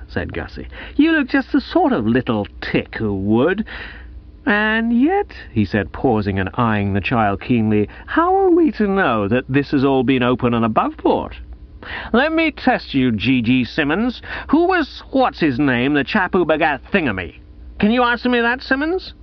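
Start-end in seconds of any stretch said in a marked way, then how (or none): 1.0-5.3 disdainful, rising high on the afterthought; Gussie, contemptuous prize-giver. Acted. Gussie eyes the child he distrusts, contemptuous and suspicious. 8.1-19.1 pompous cross-examination with high leaps in pitch; Gussie, suspicious prize-giver. Acted. Gussie tests G G Simmons on scripture, suspicious and officious.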